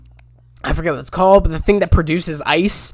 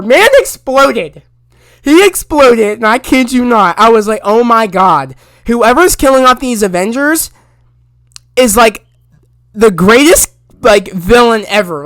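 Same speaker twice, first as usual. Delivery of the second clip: louder, higher, amazed exclamation; astonished outburst; 3 semitones higher, 6 dB louder than usual. Real, not acted. Recapping a shocking explosion to listeners, astonished. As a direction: blurt it out in disbelief, punching the big words.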